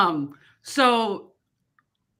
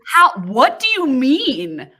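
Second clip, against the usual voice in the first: slow, louder baffled question; 6 semitones higher, 9 dB louder, much slower than usual. Real, not acted. Reacting to the coworker's story, slow baffled disbelief. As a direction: stretch it out in disbelief.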